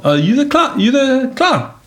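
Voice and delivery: high teasing imitation; mocking question. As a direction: mimic someone mocking a clown. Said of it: "Or told".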